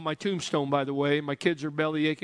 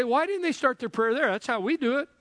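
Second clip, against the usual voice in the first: higher, quizzical objection voice; mock listener objection; 7 semitones higher than usual. Congregant asking why they pray that way, mock puzzled. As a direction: voice the puzzled churchgoer. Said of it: "You say".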